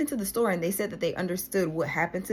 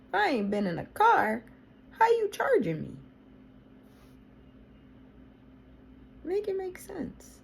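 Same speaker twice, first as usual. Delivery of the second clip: indignant disbelief; 14 semitones higher than usual. Real, not acted. Outraged at fraudulent charge.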